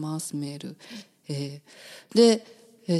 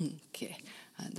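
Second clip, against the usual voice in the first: voicing the engineer's okay in a soft whisper; 17 dB quieter than usual. Host whispers the sound check okay for the engineer. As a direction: breathe a quick okay, no voice. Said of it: "トムさん、オッケーと言ってくれてます".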